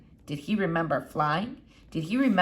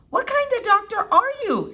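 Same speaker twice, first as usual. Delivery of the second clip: put-on much higher, louder girl; incredulous Flora; 6 dB louder than usual. A narrator's reading. Girl challenging the doctor, much higher. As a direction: scoff at this doctor.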